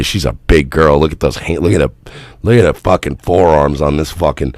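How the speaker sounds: usual speaking voice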